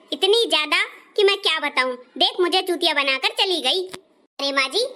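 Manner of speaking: usual speaking voice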